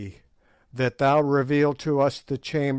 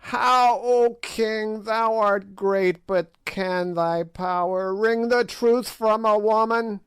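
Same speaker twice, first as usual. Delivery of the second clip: high sneering witch; old witch voice; 10 semitones higher, 4 dB louder than usual. A narrator's reading. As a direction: play the ancient hag taunting the king. Gagool mocks the king.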